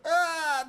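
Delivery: squealing high laugh; real laughter, very high. Real, not acted. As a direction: crack up at the bit. Laughing after the voice switch.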